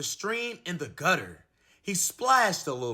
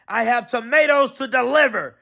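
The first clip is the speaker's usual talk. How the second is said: shouting; 6 semitones higher, 5 dB louder than usual. Acted. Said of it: "he shouted".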